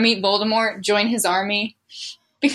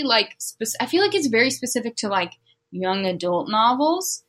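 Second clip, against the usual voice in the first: ordinary delivery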